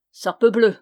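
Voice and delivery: loud, punchy curse; exclaimed oath. A narrator's reading. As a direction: burst out with the oath. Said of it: "s'écria Méloir".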